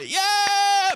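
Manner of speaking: put-on high, loud kid's cheer; a kid yelling yeah at a game. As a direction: cheer like an excited little kid. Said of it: "kind of like a kid".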